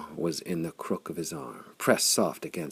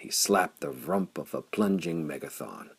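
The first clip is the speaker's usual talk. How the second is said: ordinary delivery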